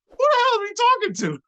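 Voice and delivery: very high, squeaky alarm; hammed-up frightened falsetto; mock panic. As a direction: squeal it in comic disbelief. Retold panic at being challenged.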